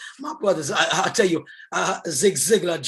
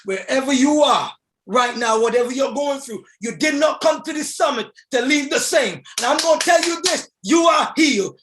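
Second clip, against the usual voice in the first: loud, higher fired-up preaching; preaching at full force; 8 semitones higher, 4 dB louder than usual. Acted. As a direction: pump the crowd up, full voice. Preaching climax.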